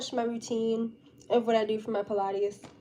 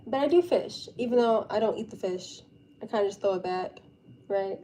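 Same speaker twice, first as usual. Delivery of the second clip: usual speaking voice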